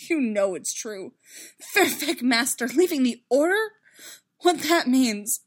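loud, incredulous, anguished protest; upset, near-choking disbelief; choked bitterness. A narrator's reading. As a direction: push it out bitterly, pitch climbing. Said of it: "he almost choked on it".

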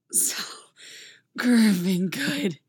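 slow, hoarse and loud. Acted. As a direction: rasp it out, overwhelmed. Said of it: "Poe says hoarsely".

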